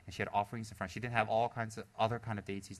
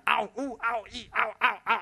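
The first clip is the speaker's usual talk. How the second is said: high, loud, hammed-up ows of sore feet; a big guy's mock cries of pain, high and loud; mock pained yelps; 11 semitones higher, 7 dB louder than usual. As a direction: yelp like a big man hobbling in pumps. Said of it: "he was walking across".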